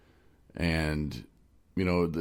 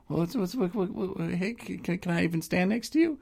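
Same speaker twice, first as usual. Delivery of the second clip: high, soft, stammering voice; Justin impression; 12 semitones higher, 3 dB quieter than usual. Host mimicking friend asking to stand near him, high and stammering. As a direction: trip over each word, pitched way up and timid. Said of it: "You heard how he talks".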